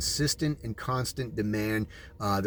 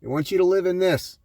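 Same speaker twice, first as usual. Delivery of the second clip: raised, fast warning; indignant preaching; 3 semitones higher than usual. Acted. Preacher showing dystopia picture, indignant fast warning. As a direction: warn them hotly what they're being sold.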